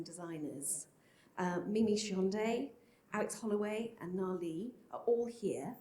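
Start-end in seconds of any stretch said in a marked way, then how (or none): none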